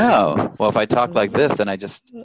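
loud, boomy announcer delivery; radio announcer voice. Shown as a demonstration. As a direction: project it like a radio host. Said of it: "sound like a radio announcer".

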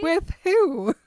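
high, loud mock disbelief; incredulous. Bella stunned that Edward offers her a ride, incredulous. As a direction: blurt it high, hammed-up astonishment.

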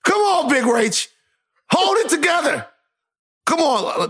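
rally her like a coach; urgent coaxing. Real, not acted. Cohost urging Rachel not to cry, high coaching shouts.

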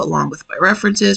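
normal talking voice

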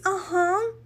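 a light mock of her Americanized "Aham"; imitating an American "aham". Retelling the girl's reply with a hint of an American accent. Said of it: "não é o aham brasileiro".

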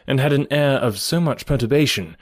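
plain reading voice

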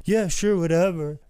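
high, loud, heedless mock reply; imitating careless wrestler. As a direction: toss it off loudly, without a thought. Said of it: "he didn't even just, like".

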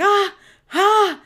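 short, high, loud "ah" yelps; mock cry of pain. Lindsay punched in the face by the mom, mock cry of pain. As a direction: imitate someone punched, crying out sharply. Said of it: "cry out in pain".

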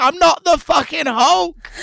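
high, slow, exasperated shout; indignant retort. Retold or imitated. The performer snaps back at a passer-by, a high, slow, loud retort. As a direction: fire it straight back at the heckler. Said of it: "And I was like".

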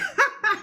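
very high mocking laugh; mock burst of laughter. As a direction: act out laughing in a challenger's face. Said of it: "he starts laughing".

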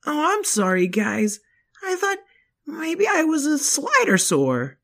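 put-on young boy dinosaur, high and eager; squeaky little kid voice; high child voice.

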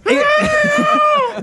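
very high, squashed squeal; mock strained yell. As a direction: yelp as if being crushed. Imitates a squashed fighter's cry.

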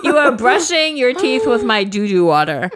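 loud, high gloating rant; mock yelling. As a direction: yell it in her face, laughing. Imagined confrontation yelled at Sasha.

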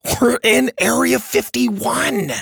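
put-on very high, drawn-out excited boy; thrilled outburst. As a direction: exclaim it slowly, bursting with excitement. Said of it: "grabbed Dylan and shook his shoulders".

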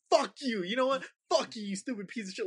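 high-pitched mock yelling; acted-out high, defiant outburst; retold screaming. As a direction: yell abuse as if at a haunted doll. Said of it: "I was just screaming and yelling at it".

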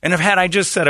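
ordinary delivery

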